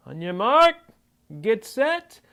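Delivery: loud, rising starter's call; race starter call. Acted.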